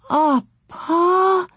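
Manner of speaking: put-on high, tearful childish wail; pleading. A narrator's reading. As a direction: plead with Pa, close to crying. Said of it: "Laura pleaded".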